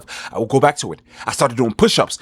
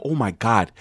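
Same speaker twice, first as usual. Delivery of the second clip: normal talking voice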